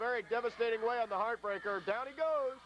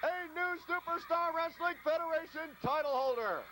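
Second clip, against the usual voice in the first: big ringside climax call; excited title call; 6 semitones higher than usual. Real, not acted. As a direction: call the winning pin like the crowd just exploded. Title-winning pinfall call.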